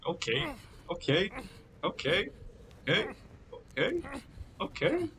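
rising questioning tune, pitch climbing steeply; climbing disbelief. Real, not acted. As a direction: repeat it, climbing to a high squeak. Repeated startled reaction, climbing in pitch.

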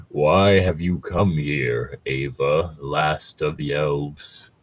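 slow, deep ancient Ent; ancient tree voice. Acted. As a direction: rumble it like an old tree.

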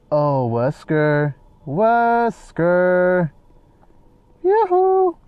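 drawn-out singsong hail, rising to a high yoo-hoo; playful calling out. Pretend. Player hunting for missing Wesker, playful calling out. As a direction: stretch the name as if calling down a hallway.

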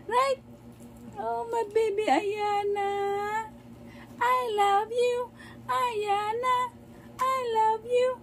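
high, slow cooing. Real, not acted. Mother cooing love to baby.

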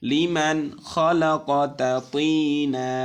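slow, loud chanted recitation; teacher chanting the verse for students; Quran recitation. Shown as a demonstration. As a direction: draw out each syllable in tajweed tune. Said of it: "তিনান পড়া যাবে".

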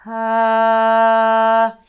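a relaxed ah let out on a sigh. Shown as a demonstration. Voice teacher showing students a relaxed sighed ah. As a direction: release the air in one easy sighing ah. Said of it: "feel like you're sighing out a phrase".

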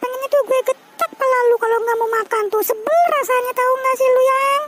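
shrill up into a nagging wife's voice, telling him off. Acted.

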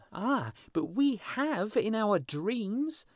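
put-on high, loud, slow child voices; children answering. A narrator's reading. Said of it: "answered the children".

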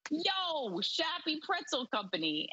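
put-on a weak, half-hearted Philly accent on yo; lightly mock Philadelphia street voice; terrible Philly accent. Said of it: "that was a terrible Philly accent".

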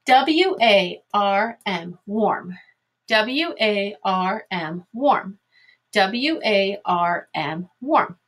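measured classroom spelling chant; slow, separated letters for pupils to follow; slow spelling drill. Shown as a demonstration. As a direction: spell it out letter by letter, then say the word. Teacher spells words aloud three times.

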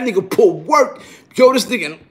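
usual speaking voice

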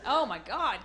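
ordinary talk, nothing marked

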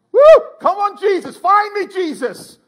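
high, loud cry of a lost sheep begging to be found; loud, high plea to be found; lost sheep calling. Acted.

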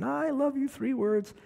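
high-pitched mock drunken call up to the window; lightly hammed drunk calling upstairs to his wife; drunk husband bellowing. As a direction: ham up a drunk pleading to be let in. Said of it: "he started bellowing".

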